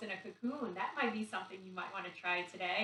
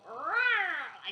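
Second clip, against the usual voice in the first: higher, louder playful bear growl; bear roar; 6 semitones higher, 7 dB louder than usual. Shown as a demonstration. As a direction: roar for the kids like a friendly bear. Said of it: "like a bear".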